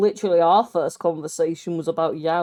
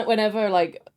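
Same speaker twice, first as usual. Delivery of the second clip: ordinary talk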